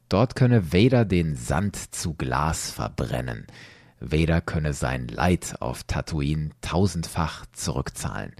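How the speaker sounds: usual speaking voice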